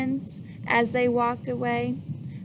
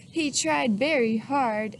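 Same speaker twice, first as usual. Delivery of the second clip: usual speaking voice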